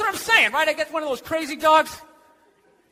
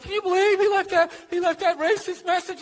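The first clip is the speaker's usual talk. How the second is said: higher, softer mock-scandalized voice; mock disbelief; 5 semitones higher, 3 dB quieter than usual. People shocked at a bounty hunter's message, mock disbelief. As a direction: imitate people being scandalized, pitched up.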